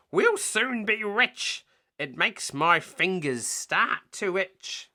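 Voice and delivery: high, slow greedy crook voice. Acted. Len to Hugh, high slow greedy voice. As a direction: drool over the money.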